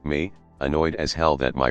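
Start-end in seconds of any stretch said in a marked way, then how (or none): none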